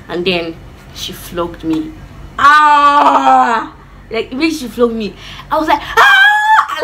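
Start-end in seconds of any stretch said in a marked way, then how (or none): 2.4-3.8 loud, long retold cry of pain; scream. Child just flogged, loud imitated scream. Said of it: "I screamed". 5.8-6.8 piercingly high, loud imitated shriek; scream. Child flogged by mom, high imitated shriek. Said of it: "Like, I screamed".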